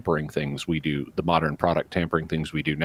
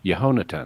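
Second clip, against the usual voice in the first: Yehonatan with the accent on ho, pitched higher; stress on HO; 4 semitones higher, faster than usual. Shown as a demonstration. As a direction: say yeh-HO-na-tan, falling after the ho in Yehonatan. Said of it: "accent was on the ho".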